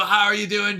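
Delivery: ordinary talk, nothing marked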